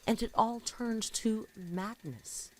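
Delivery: dramatic spoken-word poem; poem recitation. Acted. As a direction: give each line weight, like spoken word. Reads her own poem aloud.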